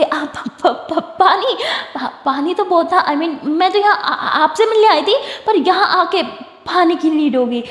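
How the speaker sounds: flustered, halting self-justification; nervous stammer. Acted. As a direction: fumble for words when caught out. Caught out, stammering excuse to mother.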